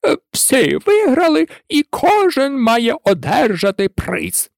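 high, drawn-out pronouncement; turkey proclaiming. A narrator's reading. Turkey announcing the winners at last, proclaiming high. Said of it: "Нарешті індик виголосив".

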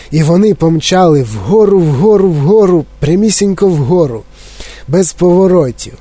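plain reading voice

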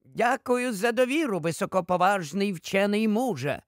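ordinary delivery, nothing marked

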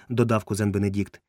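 ordinary delivery, nothing marked

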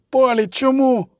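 high, anguished grieving cry; sobbing, acted. A narrator's reading. Brother grieving over the slain, high anguished sobbing. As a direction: wail it out over the dead. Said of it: "хлипав Реган Дальберг".

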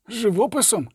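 a high, disbelieving question; incredulous. A narrator's reading. A soldier questions the order to burn paintings, incredulous. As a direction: ask as if he misheard. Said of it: "з недовірою запитав жовнір".

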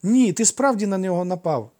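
put-on high, loud child's objection; insistent boyish voice; honest little brother. A narrator's reading. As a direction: contradict him firmly like a small boy. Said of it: "не підтримав брата чесний Фікл".

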